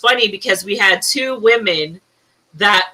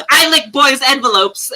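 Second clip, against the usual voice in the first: imitating a boy's confident blurt, pitched higher; confident little boy; 5 semitones higher than usual. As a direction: say it proudly, like a cocksure kid. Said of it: "just said it so confidently".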